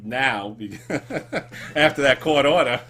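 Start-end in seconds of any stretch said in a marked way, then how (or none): 0.8-2.8 laughing through the punchline. Real, not acted. Host cracking a joke about a friend, laughing. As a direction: get the joke out between laughs.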